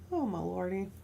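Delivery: ordinary talk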